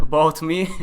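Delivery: loud laugh breaking into the words. Real, not acted. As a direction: laugh at yourself for rambling. Laughs at going off topic.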